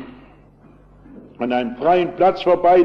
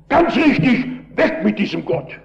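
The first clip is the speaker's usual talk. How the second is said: shouting; 7 semitones higher than usual. Retold or imitated. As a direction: shout back agreement with the miner. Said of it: "da habe ich geschrien".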